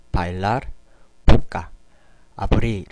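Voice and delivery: bailar, 볶아, abrir each said as a clean Korean ㅂ sound; crisp word-by-word demo of the Spanish b in 볶아. Shown as a demonstration. Teacher showing learners the Spanish B, crisp demo. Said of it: "발음은".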